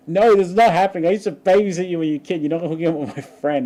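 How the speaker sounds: loud, high imitation of an outraged babysitter; angry. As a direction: scold rapidly in mock fury. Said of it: "She got so angry about it".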